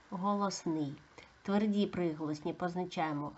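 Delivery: usual speaking voice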